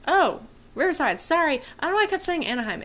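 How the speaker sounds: sudden realization. Real, not acted. Realizes she named wrong city.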